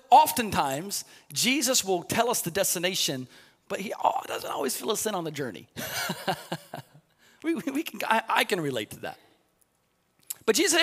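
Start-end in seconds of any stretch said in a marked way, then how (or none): 5.7-6.8 a quiet unvoiced chuckle; soft breathy laugh. Real, not acted. Preacher chuckling softly to the congregation at the joke.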